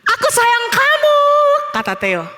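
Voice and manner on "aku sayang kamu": high, loving call. A narrator's reading. Theo calls to the echo, loving shout. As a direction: call affection across a valley.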